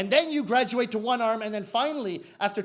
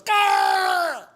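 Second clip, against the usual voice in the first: loud high karate yell; long shouted strike cry; fed-up attack cry; 10 semitones higher, 8 dB louder than usual. Acted. Fed-up sensei going to hit the student, loud yell. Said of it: "the sensei gets fed up".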